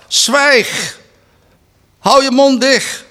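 loud, high shout to silence him; harsh rebuke. Acted. Crowd rebukes the blind man. (luid en hoog tot zwijgen sommeren)

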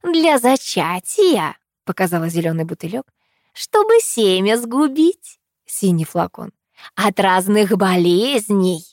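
put-on slow, emphatic old trader; crone trader voice. A narrator's reading. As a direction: sell potions like a crone. Crone lists potions, slow far.

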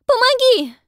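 high, loud, desperate scream; terrified cry for help. A narrator's reading. Pippa drowning calls.

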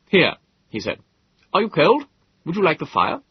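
high, eager caring host; solicitous host. Acted. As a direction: fuss over your guests. Host offers fire.

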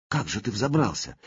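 plain reading voice

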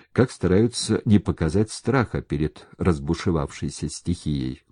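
usual speaking voice

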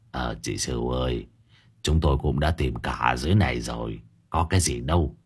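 put-on an old neighbour murmuring reassurance; old man cutting in. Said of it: "Lão Thìn bấy giờ cũng có mặt nói chen vào". (giọng ông lão trầm, nhỏ)

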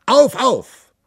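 high, loud call to arms; rallying shout. Acted. King Picro Colla calling his army to march, loud and high. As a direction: rouse the troops to march. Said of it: "brüllte Picro Colla".

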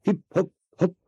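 very high, soft little hops; tiny high-pitched bounces; hopping sounds. A narrator's reading. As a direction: pip it high and softly, like mice leaping. Narrator imitates hopping mice.

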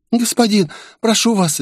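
high, shaking plea for mercy; trembling with terror. A narrator's reading. Girl pleading with her captor, high and terrified. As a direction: beg while shaking with fear. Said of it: "девчушку начало трясти от ужаса".

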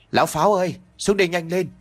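calling out. Acted. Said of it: "gọi với lên trên".

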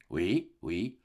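low, soft yes-yes; husband's subdued assent; placating husband. Acted. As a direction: brush her off in a low, quiet voice. Husband answers his wife's protests.

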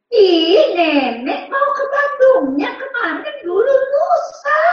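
put-on high, drawn-out kid protest; slow, high-pitched childish insistence; exasperated child. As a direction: whine it slowly up high at grandma. Exasperated child explains to grandmother.

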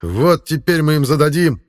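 high, loud gleeful threat; eager battle cry. Acted. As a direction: relish the coming fight.

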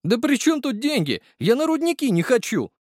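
high, whiny lament; the driver wailing that he fears the mines; wailing young driver. Acted. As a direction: whine it in a high voice. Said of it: "запричитал паренёк".

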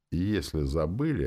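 usual speaking voice

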